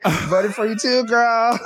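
sarcastic quote. Retold or imitated. Imitating the shady reply.

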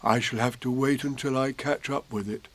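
puzzled teddy bear; mild Pooh voice. Acted.

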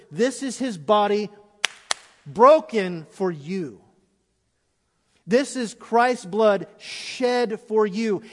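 loud, slow, drawn-out preaching; slow thundering sermon proclamation; preaching at full force. Acted. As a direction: proclaim each word slowly and loudly. Preaching the communion words.

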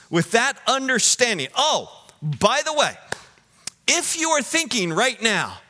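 high, energetic pulpit voice; high, emphatic sermon delivery; forceful preaching. Real, not acted. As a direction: raise the question with preaching fervour.

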